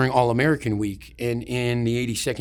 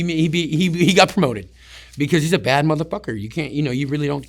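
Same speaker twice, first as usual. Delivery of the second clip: ordinary delivery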